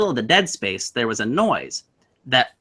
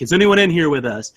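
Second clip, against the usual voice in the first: mock spooky questions; 5 semitones higher, 3 dB louder than usual. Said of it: "we're kinda goofing with each other".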